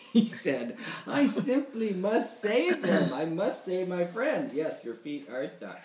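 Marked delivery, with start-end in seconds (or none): 1.0-4.5 higher, earnest character voice; slow, deliberate vow to save a friend; lightly voiced straining hero; determined hero. A narrator's reading. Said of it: "he said over and over again".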